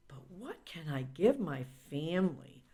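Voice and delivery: put-on whispered child's question. Child asking the narrator what to give, whispered. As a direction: whisper it like a shy kid.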